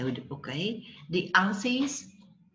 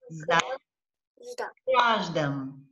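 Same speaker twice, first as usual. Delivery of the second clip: "za" held apart, pause, then "graj, dam"; syllable by syllable; slower than usual. Shown as a demonstration. Teacher shows where syllables are, syllable by syllable. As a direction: clap out "za, graj, dam" with a gap. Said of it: "clapping our hands".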